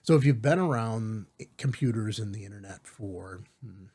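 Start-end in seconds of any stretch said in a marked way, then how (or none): none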